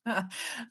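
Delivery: quiet breathy laugh; a short soft chuckle. Real, not acted. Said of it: "I'm just laughing over here".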